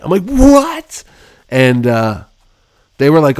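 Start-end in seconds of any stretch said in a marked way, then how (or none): 0.2-1.0 much higher disbelieving what; shocked what. Retold or imitated. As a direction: react stunned.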